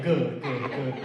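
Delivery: low, slow satisfied good good good; friend's deep approval. Retold or imitated. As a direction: nod it through like a pleased friend. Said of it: "My friend was like".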